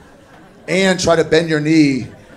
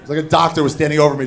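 normal talking voice

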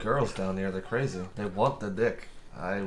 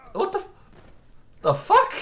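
high, loud "What the fuck?"; startled outburst; 9 dB louder than usual. Real, not acted. Zombie runs right past him, startled high loud yelp. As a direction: yelp it in disbelief, much higher.

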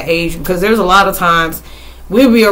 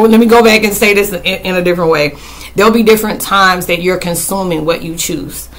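ordinary talk, nothing marked

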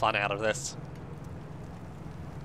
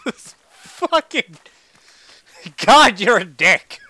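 high, loud cursing; drawn-out, loud swearing outburst; exasperated shout; 6 semitones higher, 12 dB louder, much slower than usual. Real, not acted. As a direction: yell at the one who just scared you. Swearing at whatever startled them.

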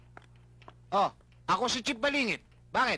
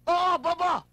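angry scolding; 5 semitones higher, 4 dB louder, slower than usual. Acted. Chief scolding his officer.

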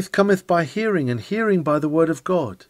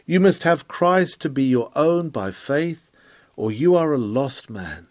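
usual speaking voice

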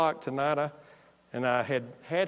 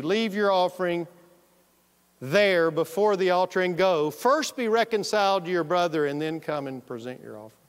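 raised, louder solemn reading of the verse; scripture reading; 3 semitones higher, 4 dB louder than usual. Acted. Preacher reads the verse to his congregation, solemn and raised.

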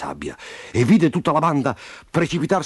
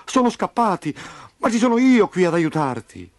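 put-on raised, eager boy's voice; earnest reassurance; 8 semitones higher than usual. A narrator's reading. Memo telling trapped Roby he will help, reassuring.

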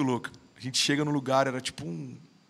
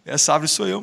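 louder, weighty restatement; emphatic repeat; 6 dB louder than usual. Real, not acted. Telling the podcast hosts the tree is him, louder and emphatic. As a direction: land it hard the second time.

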